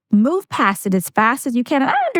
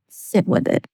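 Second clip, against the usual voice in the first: hushed; 3 semitones lower than usual. Shown as a demonstration. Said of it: "Learn to just".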